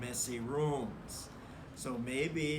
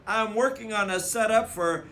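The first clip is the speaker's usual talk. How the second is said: loud, higher indignant brother; offended big brother standing firm; insisting; 7 semitones higher, 12 dB louder than usual. Acted. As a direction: correct her hotly. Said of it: "Brother insisted".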